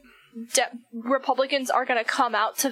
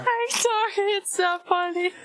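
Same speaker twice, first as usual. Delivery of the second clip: much higher voice, helpless laughter; hysterical laugh; 8 semitones higher, slower than usual. Real, not acted. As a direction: laugh too hard to stop. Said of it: "Why are you laughing so hard?".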